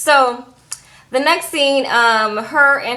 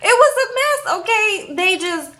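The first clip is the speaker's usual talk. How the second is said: high-pitched, through laughter; helpless high laughing voice; real laughing; 9 semitones higher than usual. Real, not acted. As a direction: still cracking up as you sum it up. Still laughing at the scene.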